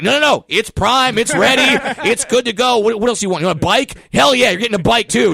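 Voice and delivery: high, loud and overjoyed; high loud excited giveaway; gleeful shouting. Retold or imitated. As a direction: whoop like a giddy game show host.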